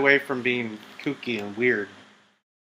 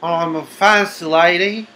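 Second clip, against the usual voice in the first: slow, higher, mock-Australian-sounding accent; put-on Lara Croft voice; 3 semitones higher, 6 dB louder, slower than usual. Player posing as Laura Croft, put-on accent. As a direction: play a fancy lady in a drawling, badly faked accent. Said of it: "doing whatever voice I could think of".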